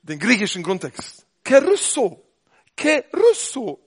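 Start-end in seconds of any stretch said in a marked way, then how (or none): 1.4-3.8 "Kerusso" said slowly and a little high, each syllable spelled out; Greek word. Shown as a demonstration. Preacher teaching the Greek word "Kerusso" slowly. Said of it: "Schreien biblisch ist".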